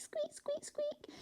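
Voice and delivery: high, soft squeaking mouse; soft little mouse squeaks for the class. Shown as a demonstration. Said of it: "go squeak".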